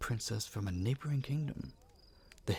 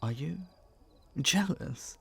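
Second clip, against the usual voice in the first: usual speaking voice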